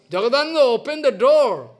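loud, high call through the door. Retold or imitated. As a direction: call him to open up. Mahaprabhu calling at locked door.

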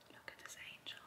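breathy whisper to the cat. Real, not acted. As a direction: whisper it softly, adoring.

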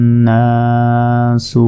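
"আমানান্নাসু" slowly, humming long on the double n; recited with ghunnah. Shown as a demonstration. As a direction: stretch the vowels and hold the nn in "আমানান্নাসু". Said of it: "ওয়াজিব গুণা". ("আমানান্নাসু" ধীরে, গুন্নাহ করে টেনে পড়া)

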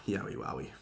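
soft sighing whisper. Real, not acted. Bracing to review the main event, hushed whisper. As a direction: breathe it out under your breath.

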